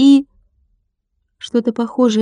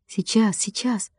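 hushed, much softer reassurance to himself; whisper; 9 dB quieter than usual. A narrator's reading. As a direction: half-whisper it, dropping away. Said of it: "прошептал Владик". (прошептать тихо)

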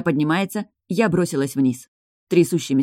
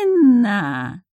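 higher, louder rising squeak; squeaky doll voice; 4 semitones higher, 4 dB louder than usual. A narrator's reading. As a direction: squeak the name like a creepy toy. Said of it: "снова пискнула игрушка".

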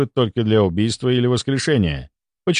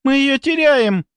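very high, drawn-out alarmed shout; panicked cry; 4 dB louder than usual. A narrator's reading. A young colleague cries that they are losing her, panicked. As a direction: wail the warning, pitch right up. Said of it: "закричала Маша".

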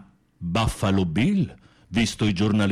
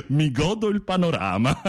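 high giggle into the words; laughing tease; 7 semitones higher than usual. Real, not acted. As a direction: laugh through the teasing line. Teasing about the toilette.

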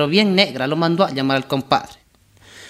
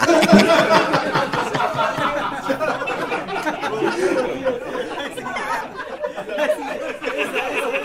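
long real laugh at own joke; 11 semitones higher than usual. Real, not acted. Teller laughs at his own punchline, long laugh. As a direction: let a long laugh roll out.

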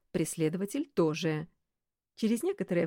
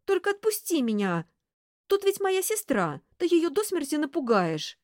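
pleading; 10 semitones higher, 4 dB louder than usual. Acted. Pinned woman pleading.

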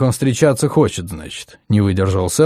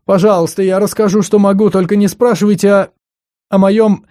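tearful; 8 semitones higher, 3 dB louder than usual. A narrator's reading. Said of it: "по щекам сье Обри потекли слёзы".

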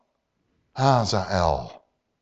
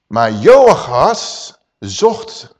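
drawn-out emphasis; 3 semitones lower, 6 dB louder, much slower than usual. Acted. Preacher drags out the key word.

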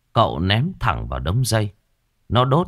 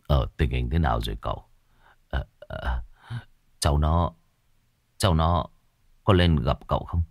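a hint of a low, hesitant old man; old man asks softly, a little lower; lightly voiced old man; 5 semitones lower, 3 dB quieter than usual. A narrator's reading. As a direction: play the worried old father, low and halting.

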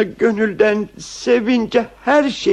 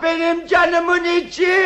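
high, raised-voice endearment; shouting; 6 semitones higher than usual. Acted. Husband with cap over his ears to wife, unwittingly shouting. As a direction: call it out as if you cannot hear yourself. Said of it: "Ne diye bağırıyorsun yavrucuğum?".

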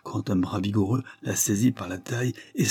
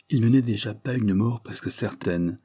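ordinary delivery

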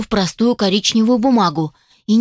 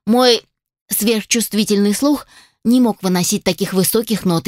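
ordinary delivery

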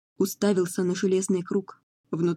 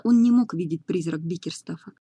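ordinary delivery, nothing marked

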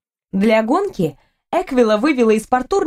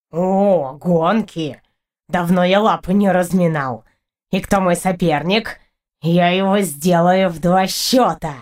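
low, cocky creature voice; swaggering cat, pitched low; boastful cat; 4 semitones lower, slower than usual. A narrator's reading. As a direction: brag in a deep cat voice. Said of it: "Хвастливо мявкнул Портур".

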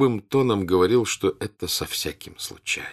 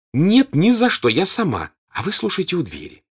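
put-on insistent girl; 10 semitones higher, 6 dB louder than usual. A narrator's reading. Natasha insisting eagerly.

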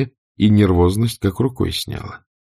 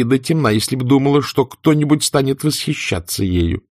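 furious retort; 8 semitones higher than usual. A narrator's reading. Scarlett furious in quarrel.

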